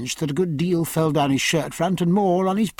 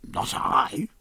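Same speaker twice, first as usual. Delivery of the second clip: whisper; 5 dB quieter than usual. A narrator's reading. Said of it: "unvoiced whisper".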